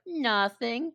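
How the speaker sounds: high, innocent-sounding fib; Pinocchio. Retold or imitated. Pinocchio fibbing to Jiminy, high innocent voice. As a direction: play the little liar, pitched high. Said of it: "And Pinoc's like".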